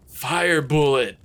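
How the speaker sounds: slow, loud battle cry pitched high; loud, drawn-out story shout. A narrator's reading. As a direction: shout it like an attack call. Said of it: "Dante shouts".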